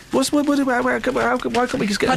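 sudden high gabbled words; high squeaky babble. Pretend. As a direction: squeak it out fast and garbled.

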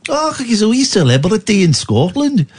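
put-on Scottish voice, mocking; Scottish-accented mockery of a self-important comedian. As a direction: sneer it in an imitation Scottish accent. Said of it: "Don't do the voice".

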